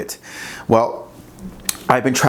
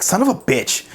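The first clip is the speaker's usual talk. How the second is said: higher-pitched mock frustration; retold exasperation; 9 semitones higher than usual. Coach recalling his score dropping back to fifty, exasperated. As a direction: blurt it like an exasperated curse.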